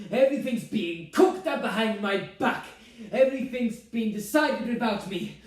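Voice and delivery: loud, high, indignant mother; aggrieved tirade. Acted. Mother accusing son of plotting, aggrieved. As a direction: pile up grievances in full voice.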